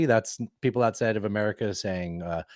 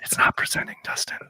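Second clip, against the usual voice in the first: unvoiced stage whisper; mock-secretive whisper. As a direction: whisper it to a colleague.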